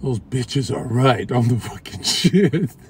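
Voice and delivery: slow, drawn-out smug musing; self-satisfied bedtime gloating. Acted. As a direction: savour it slowly, as if drifting off pleased. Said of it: "going to bed like this".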